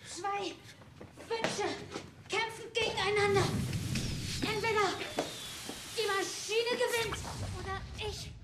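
high, strained, pained pleading; feverish distress. Acted. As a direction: cry out weakly in feverish pain. Sams sick, begging for help.

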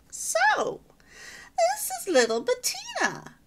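grand, high and loud society lady; lofty matron announcing herself at the door; majestic voice. Acted. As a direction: sweep in like a grand dame. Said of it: "said a majestic voice at the door".

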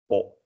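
O said with very rounded lips; a pure rounded Dutch O. Shown as a demonstration. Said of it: "Really round lips".

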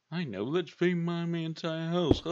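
mock your own bright idea, loudly; sarcastic self-mockery. Mocks own foolish decision.

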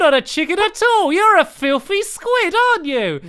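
loud, high-pitched accusation; play-acted indignant shout; mock outrage. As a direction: expose the impostor in hammed-up fury. Shopkeeper unmasks the squid.

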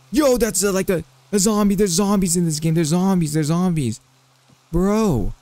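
high startled yelp, easing as it repeats; alarmed. Real, not acted. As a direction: cry out in alarm at a sudden threat. Startled by zombies in game.

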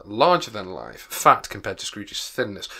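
usual speaking voice

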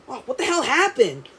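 ordinary talk, nothing marked

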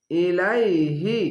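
ইলাইহি recited slowly, each syllable held; Quranic recitation demo. Shown as a demonstration. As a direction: chant ইলাইহি slowly and evenly. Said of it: "আলিফকে হামজা পড়বেন". (ধীরে তিলাওয়াত করে ইলাইহি)

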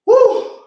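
high, loud whoop of triumph; joyful yell after finishing the set; excited whoop. Real, not acted. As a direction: let out a big celebratory woo. Hype shout after a set.

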